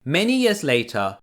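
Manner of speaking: ordinary delivery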